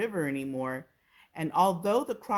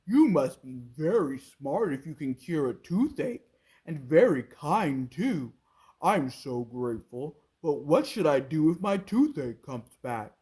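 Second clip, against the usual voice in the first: loud crocodile waving it off; booming, grateful no; 3 semitones lower, 5 dB louder than usual. A narrator's reading. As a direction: crocodile refusing his old tooth. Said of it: "he said".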